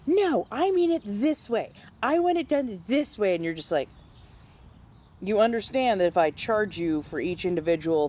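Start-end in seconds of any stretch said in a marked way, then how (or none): 0.1-3.4 mock entitled customer, higher; whiny demanding customer voice, pitched up. Barista mimicking a fussy customer, mock whiny.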